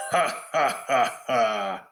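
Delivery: slow, deliberate villain laugh; mock monster laughter; Groomsh's taunting laugh. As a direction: laugh out each "ha" as a separate taunt. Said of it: "Groomsh yells out".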